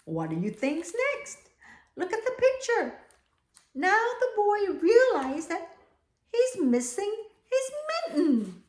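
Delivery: ordinary delivery, nothing marked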